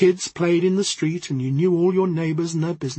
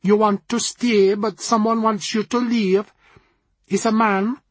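put-on higher, mysterious Jamaican accent; 4 semitones higher than usual. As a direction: Jamaican palm reader, probing. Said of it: "in a rich Jamaican accent".

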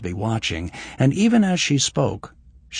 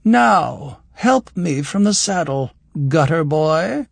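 haughty, higher and louder command; imperious noblewoman; 5 semitones higher, 4 dB louder, slower than usual. A narrator's reading. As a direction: order him like a servant. Noblewoman orders him.

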